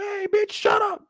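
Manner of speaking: high, slow mock yell at her; thugs telling her off. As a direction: snap it at her, dragging the words. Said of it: "They like".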